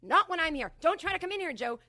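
high, loud shouted warning; pretend angry warning. Protective sister warning Joe off, shouting. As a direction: yell it to stop someone at the door. Acted fantasy confronting Joe.

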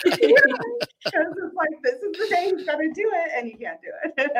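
laughing. Real, not acted. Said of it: "Like he's having a heart attack".

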